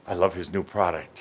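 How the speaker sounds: ordinary talk, nothing marked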